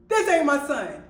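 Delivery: acted-out loud, high insistence; alarmed outburst. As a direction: blurt it out in mock alarm. Acting out her panicked realization.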